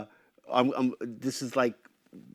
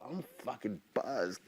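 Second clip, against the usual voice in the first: slurred and slow, tipsy; drunk; 10 dB quieter, slower than usual. Retold or imitated. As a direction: talk like you've had a few. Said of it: "right when I was drunk".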